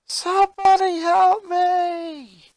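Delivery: high, slow, drawn-out cry for help; desperate yell for help. A narrator's reading. Boy pinned by a gator screaming for help, drawn-out yell. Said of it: "Lincoln yelled".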